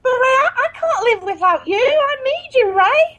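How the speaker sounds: pleading. Acted. Pleading ex begging on phone.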